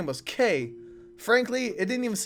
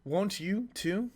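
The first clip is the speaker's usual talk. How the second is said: quiet, slow, drawn-out question; soft slow plea; 6 dB quieter, much slower than usual. Acted. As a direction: ask it softly and slowly. Closing line of character speech.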